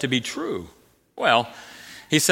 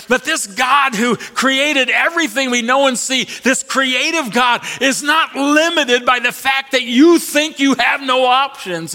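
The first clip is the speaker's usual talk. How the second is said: higher and louder, rising to a shout; preaching climax; 8 semitones higher, 4 dB louder than usual. Acted. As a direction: build to a full-force proclamation.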